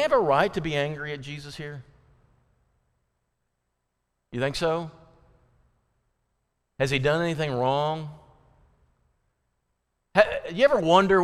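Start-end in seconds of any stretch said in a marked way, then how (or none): none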